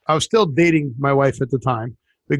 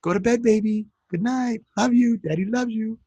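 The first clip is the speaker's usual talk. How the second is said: tender bedtime voice; 5 semitones higher, 4 dB quieter than usual. Pretend. Father soothing baby at bedtime.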